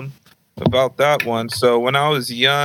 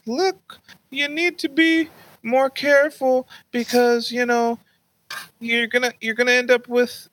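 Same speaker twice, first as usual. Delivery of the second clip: lecturing grandma; 12 semitones higher than usual. Retold or imitated. Grandma calling grandson to warn him, high lecturing imitation. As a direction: admonish in a sustained high old-relative voice.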